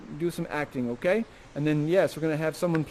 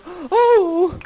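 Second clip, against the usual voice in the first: a high, loud startled oh; gasping oh; 11 semitones higher, 7 dB louder than usual. Acted. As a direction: gasp it out in surprise. She goes oh.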